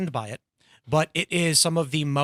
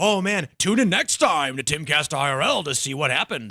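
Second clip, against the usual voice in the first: lightly mock announcer delivery; hammed-up cliffhanger teaser; 4 dB louder than usual. Host imagines everyone after the cliffhanger, in a hammed-up teaser voice. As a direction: play up a mock tune-in-next-time tease. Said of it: "everyone's gonna be like".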